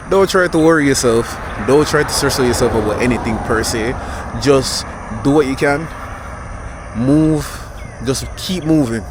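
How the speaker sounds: rally them to keep going; emphatic urging. Real, not acted. Urging viewers to keep moving.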